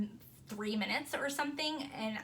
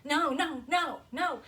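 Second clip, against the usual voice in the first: imitating frightened protest; 5 semitones higher, 5 dB louder than usual. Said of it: "making, like, noises like".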